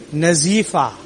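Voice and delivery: ordinary talk, nothing marked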